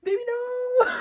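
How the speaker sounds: very high, loud hammed-up wail; mock sobbing. Reader overcome by the reading, mock sobbing wail. Hammed-up heartbreak, echoing a sitcom scene.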